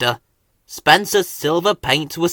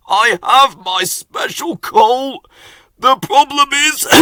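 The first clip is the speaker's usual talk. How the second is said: wheezing, strained engine voice; wheezy; 9 semitones higher, 3 dB louder than usual. A narrator's reading. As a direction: push the words out through a wheeze. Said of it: "Henry wheezed".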